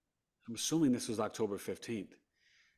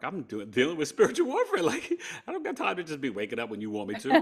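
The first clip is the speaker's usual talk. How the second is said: laughing defiant boast; 5 semitones higher, 6 dB louder, faster than usual. Retold or imitated. Retelling his defiant reply, laughing.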